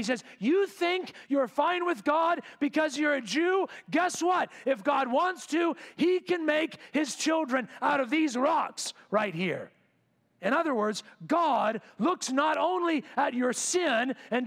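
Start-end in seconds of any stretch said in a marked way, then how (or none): 0.4-9.7 higher, louder preacher's challenge, thrown out at the crowd; John's fiery rebuke. Retold or imitated. John to Pharisees at the Jordan, loud and higher fiery rebuke. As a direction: call them out like a prophet on the riverbank.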